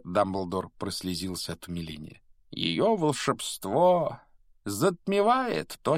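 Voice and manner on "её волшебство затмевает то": high, very slow marvelling; rapturous. Acted. Headmaster moved by the anthem, marvelling slowly. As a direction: stretch the words out in wonder. Dumbledore moved to tears by music.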